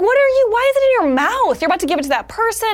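high-pitched incredulous scolding, retold; squeaky-high mock outrage; appalled disbelief. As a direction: demand an answer, grossed out and high. Retold disbelief at ticket in mouth.

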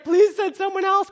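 loud, high-pitched begging; exasperated plea. Acted. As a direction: beg at full voice. Moses pleads at breaking point.

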